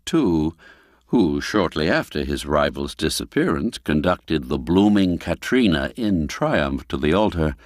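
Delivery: plain reading voice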